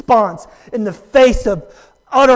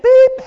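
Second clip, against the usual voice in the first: high, loud imitated phone beep; mimicking a phone's alert tone; 9 dB louder than usual. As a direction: beep like an incoming text.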